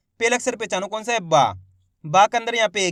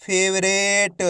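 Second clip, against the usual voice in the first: slow blended reading; much slower than usual. Shown as a demonstration. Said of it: "तो मिलके बन जाएगा".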